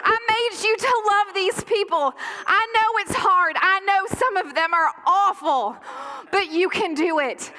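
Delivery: high impassioned cheer, easing gradually; God cheering on. Acted. God cheering on believers out of the woods, impassioned urging. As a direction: urge them on like a coach at the race. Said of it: "He waits for every moment ... to say".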